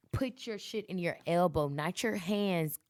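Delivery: ordinary talk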